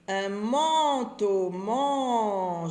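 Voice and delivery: manteau with a long, stretched nasal "man"; drawn-out nasal "an". Shown as a demonstration. Teacher showing readers the sound in manteau, "an" drawn out. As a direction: stretch the "an" in manteau, loud and slow. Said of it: "j'entends en".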